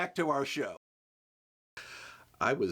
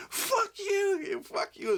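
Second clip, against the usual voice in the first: mock cursing crew; slower than usual. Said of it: "They fucking curse me".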